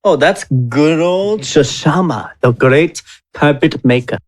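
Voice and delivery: usual speaking voice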